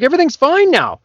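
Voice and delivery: high, cheerful patient voice; squeaky relieved patient. Acted.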